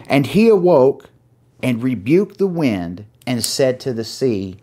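scripture reading. Acted. Pastor reading Mark to his flock, solemn. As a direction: read the verse with weight to the congregation.

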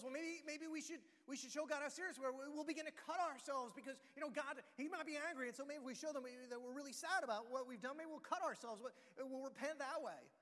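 high, loud anxious reasoning; worried prophets. Acted. Prophets of Baal fretting aloud, high and loud. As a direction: talk yourselves into it.

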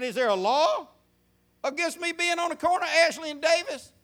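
high-pitched, mildly indignant comeback; defiant challenge. Retold or imitated. Young man talking back to a cop, defiant. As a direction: push the question up high, challenging.

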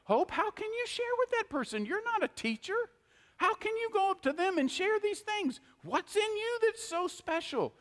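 high, sneering accuser; mock the listener as the devil would; a lightly voiced accusing devil, high and mocking; taunting devil voice. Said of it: "He'll come to you and say".

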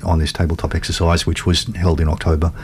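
ordinary delivery

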